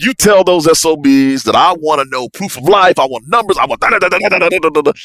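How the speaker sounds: high, loud, heated orders; angry demand. Retold or imitated. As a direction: bark the demands in anger. Ordering the translator in anger.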